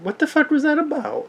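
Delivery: squeaky baffled repeat; mock bewildered. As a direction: throw it up high like you can't believe it. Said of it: "I'm like".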